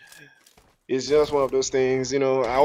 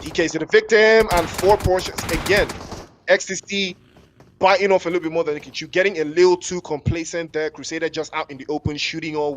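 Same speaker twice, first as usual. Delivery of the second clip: higher, louder rapid esports call; live play-by-play; 4 semitones higher, 6 dB louder than usual. Real, not acted. Caster reacting to a surprise kill for the stream, excited high play-by-play. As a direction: jump on the kill and keep the energy up.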